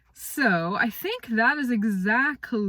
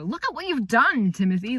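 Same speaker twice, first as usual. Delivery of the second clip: higher, louder mock scolding; scolding parent; 6 semitones higher, 7 dB louder than usual. Parent scolding Timothy for stealing, a mock scolding tone. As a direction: tell him off like a cross parent. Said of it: "more of a".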